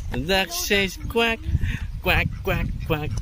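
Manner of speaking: high, slow sing-song duck quacks; reading to a toddler. Shown as a demonstration. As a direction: play the duck for a little one. Teaching child duck sounds.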